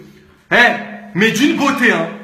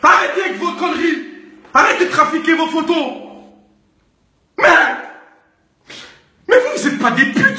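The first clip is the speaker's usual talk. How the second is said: angry yelling; 6 semitones higher than usual. Acted. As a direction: shout the order at them. Yelling at girls.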